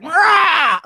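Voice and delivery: high mock beast roar; enraged roar. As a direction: burst into a play roar of rage. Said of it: "when I just see red".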